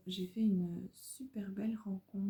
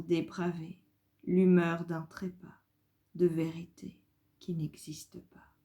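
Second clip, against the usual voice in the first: solemn verse read aloud, phrase by phrase; poem reading; 6 dB louder than usual. Acted. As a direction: declaim each line, then let it hang. Le titre c'est Liberté de penser.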